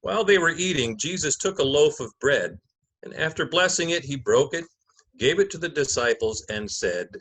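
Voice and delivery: measured, solemn Gospel narration; liturgical reading. Acted. Narrator opening the Passion for the congregation, solemn reading. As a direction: read it like Scripture from the lectern.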